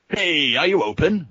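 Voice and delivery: rowdy Nebulons demanding service on entering. Acted. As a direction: bark it at the bartender. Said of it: "they demand".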